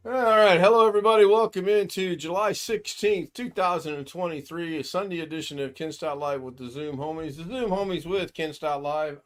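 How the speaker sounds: high, loud showman opening; grand entrance announcement. Acted. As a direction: boom it out like a show host. Host opens the live show.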